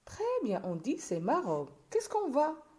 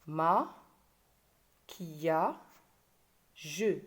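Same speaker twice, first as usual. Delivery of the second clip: "Ma... qui a... je" in three beats with long pauses; lower, isolated syllables "Ma", "qui a", "je"; split into syllables; 5 semitones lower, much slower than usual. Shown as a demonstration. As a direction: count out "Ma, qui a, je" piece by piece. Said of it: "trois syllabes".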